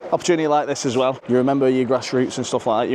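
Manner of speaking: ordinary talk, nothing marked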